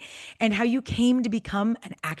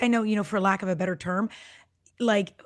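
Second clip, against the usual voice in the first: ordinary talk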